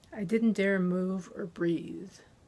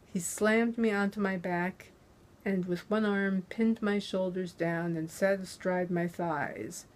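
plain reading voice

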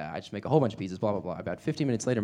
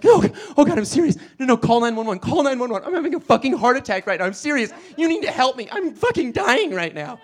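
much higher, louder mock panic; fake heart attack; 10 dB louder than usual. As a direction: ham up a heart attack, crying for help. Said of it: "clutching my chest".

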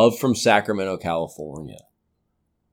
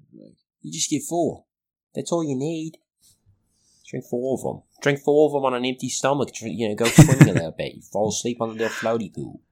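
put-on Australian accent; 6 semitones higher than usual. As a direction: keep up the fake Australian ad bit. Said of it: "I can't do an Australian accent".

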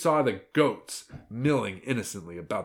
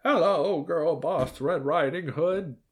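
high, slow, wavering greeting; grandma voice; 7 semitones higher, slower than usual. A narrator's reading. Grandma greets her young visitor, slow granny voice. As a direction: draw it out as an old granny. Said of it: "so the grandma says".

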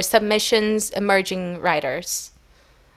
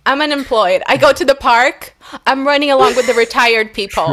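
louder, mock-dramatic complaint; comic lament; 4 semitones higher, 6 dB louder than usual. Real, not acted. Joking to friends about being jobless, hammed-up. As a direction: play up the sorry state for laughs.

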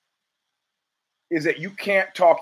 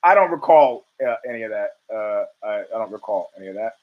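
low, fading mock McConnell voice; imitating McConnell; 7 semitones lower than usual. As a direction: mumble the denial away as McConnell. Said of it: "Mitch McConnell goes".

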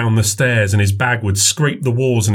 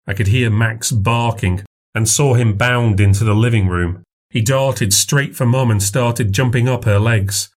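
ordinary delivery

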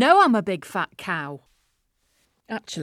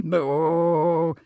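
cow moo; 6 semitones lower than usual. Acted. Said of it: "adding a few moos".

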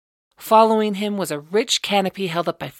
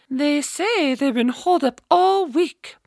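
gossiping matron; 10 semitones higher, 4 dB louder than usual. A narrator's reading.

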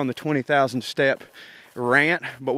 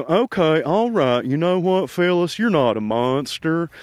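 Mister Mackey slash Doctor Phil; 2 semitones higher than usual. Retold or imitated. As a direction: play a patronizing school counselor. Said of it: "kinda Mister Mackey, too, from South Park".

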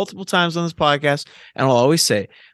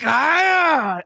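mock Venom yell; 14 semitones higher than usual. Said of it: "talking to himself in his head, like".

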